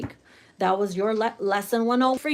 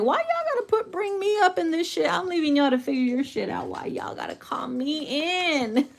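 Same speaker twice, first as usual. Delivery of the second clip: much higher, put-upon Lord; hammed-up annoyed God; an exasperated deity brushing everyone off; 6 semitones higher than usual. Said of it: "the good old Lord is like".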